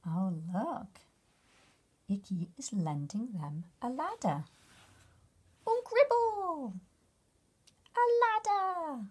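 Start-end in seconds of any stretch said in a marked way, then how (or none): 5.7-9.1 high, slow squeaky bug cry; little bug gasping with delight; excited bug voice. Acted. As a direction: cry out like a tiny thrilled bug. Bugs exclaim in gibberish.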